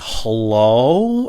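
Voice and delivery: slow, loud "hello" rising at the end; wary phone answer. Retold or imitated. Answering a phone completely cold, wary rising "hello". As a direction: stretch "hello" into a long rising question.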